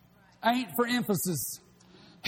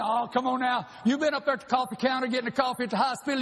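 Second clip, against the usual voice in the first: usual speaking voice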